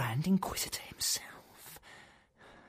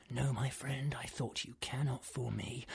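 a sly whisper to himself; secretive whisper; 3 semitones lower, 9 dB quieter than usual. Acted. Madman hiding under the table whispers to himself.

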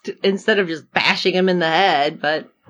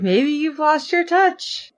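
high, slow, tentative suggestion; playful sing-song doubt; mock tactful teasing; 8 semitones higher than usual. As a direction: break it to them gingerly, pitched high. Teasing a character's failed flirting.

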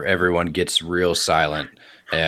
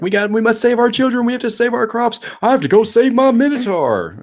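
high, loud crowd panic; hammed-up high-pitched alarm; panicked screaming; 6 dB louder than usual. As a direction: cry out like frantic villagers. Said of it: "they all start screaming".

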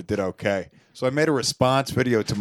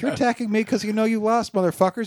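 higher, taunting mock rant; retold video comeback; 10 semitones higher than usual. Host replays his comeback to haters, higher taunting voice. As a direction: lord it over the haters in a higher voice. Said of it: "I was like".

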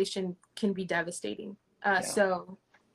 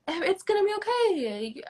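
light, much higher comforting reply; reassuring voice of God; 10 semitones higher than usual. Retold or imitated. Voicing God's reply to her anger, mild reassuring. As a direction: sound like a gentle reassuring answer.

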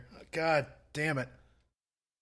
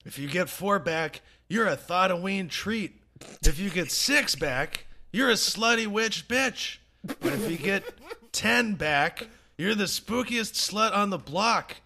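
higher, louder, sassy party voice; spooky chain-letter voice; 6 semitones higher, 5 dB louder than usual. Acted. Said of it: "bringing the spooky energy, uh, from that intro".

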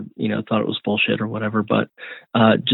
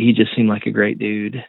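ordinary talk

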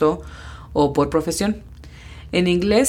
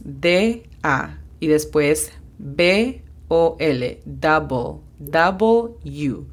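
slow spelled-out breakdown; much slower than usual. Shown as a demonstration. Teacher slowly spells out how to think of W. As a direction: spell out each piece slowly, letter by letter. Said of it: "hacer de cuenta que".

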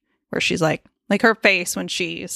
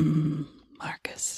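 slow breathy moan-whisper; 2 semitones lower, 9 dB quieter, much slower than usual. Pretend. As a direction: purr the name slowly. Flirty whisper at Marcus.

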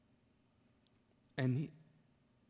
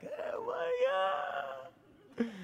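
high, long drawn-out mock wonder; gleeful amazement; 8 semitones higher, much slower than usual. Brother grinning after the prayer, gleeful amazement. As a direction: stretch it out high through a big grin. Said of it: "brother's got the biggest grin".

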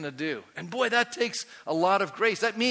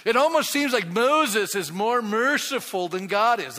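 emphatic; 6 semitones higher, 7 dB louder than usual. Real, not acted. Repeats point, first take emphatic.

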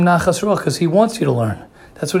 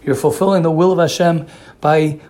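ordinary delivery

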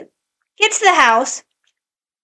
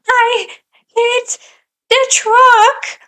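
put-on high, small child voice, much higher; little daughter's voice; 10 semitones higher, 3 dB louder, much slower than usual. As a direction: squeak it out like a hurt little girl. Mother voices crying daughter.

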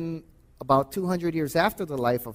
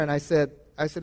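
ordinary talk, nothing marked

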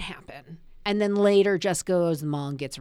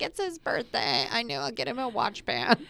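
mock airhead; 4 semitones higher than usual. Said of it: "She's like".

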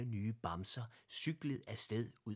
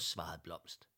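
usual speaking voice

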